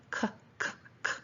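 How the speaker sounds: bare K clicks, voiceless; the sound K alone, not the letter name; isolated sound. Shown as a demonstration. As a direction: repeat K as short separate consonant pops. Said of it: "Which k".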